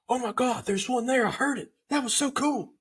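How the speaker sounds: high, bubbly imitation of Erica; excited Erica. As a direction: squeal it like a thrilled first-timer. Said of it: "Erica was so excited. She's like".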